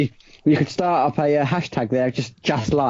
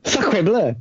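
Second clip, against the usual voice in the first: higher-pitched mock French exclamation; French accent; 7 semitones higher than usual. Joking about French subtitles for the podcast, mock French accent. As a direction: put on a comic French accent. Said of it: "you just put the French accent".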